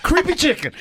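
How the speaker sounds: playful Puerto Rican accent imitation; thick Puerto Rican accent. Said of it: "That's how thick her accent is".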